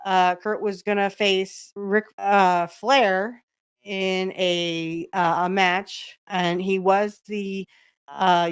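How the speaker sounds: ordinary talk, nothing marked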